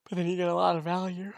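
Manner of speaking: talking through a yawn; words stretched by a yawn. Real, not acted. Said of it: "sorry for yawning".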